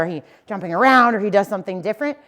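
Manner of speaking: ordinary talk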